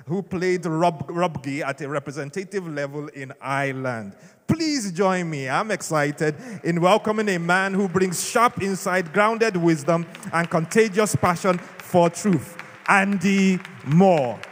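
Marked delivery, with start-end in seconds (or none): 4.4-12.6 louder, building up to the name; big stage welcome for the speaker; excited announcer intro. Real, not acted. Host announcing the guest speaker. 12.9-14.4 much louder, slow, drawn out; announced name. Real, not acted. Host's big announcement of the name.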